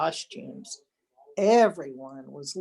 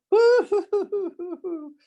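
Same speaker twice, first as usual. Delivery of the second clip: very high, louder hammed-up boo-hoo sobs; comic sobbing; 12 semitones higher, 5 dB louder than usual. A narrator's reading. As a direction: blubber like scared pups. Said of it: "sobbed the dogs".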